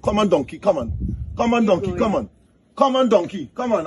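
lightly voiced master coaxing his animal along; master urging donkey. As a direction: urge the donkey on in short, high calls.

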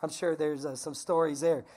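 normal talking voice

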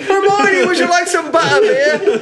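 high, silly British-Irish lilt, easing down; mock British-Irish accent. Playing a wizard flirting with Hermione, mock British-Irish accent. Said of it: "That's Ireland, or what was that?".

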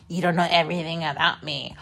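fast, soft put-on mom voice; mocking mom. Daughter mimicking her mom's brush-off, soft and quick. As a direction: rattle it off like a dismissive mom.

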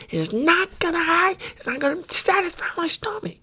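very high-pitched protest; exasperated. Real, not acted. Exasperated rant about eating.

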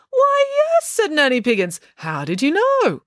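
loud, high-pitched delight; delighted surprise. A narrator's reading. Nanny Piggins thrilled at being guessed, loud high delight. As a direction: gush with flattered delight.

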